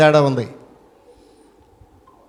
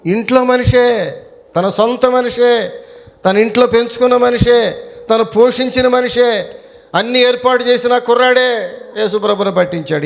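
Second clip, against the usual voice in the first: stack each phrase like an accusation from the pulpit; impassioned preacher's lament; fervent preaching; 8 semitones higher than usual. Acted. Impassioned build about betrayal.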